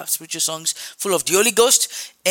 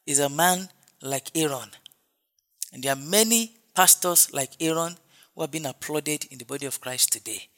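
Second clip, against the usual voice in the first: ordinary talk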